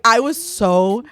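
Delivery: usual speaking voice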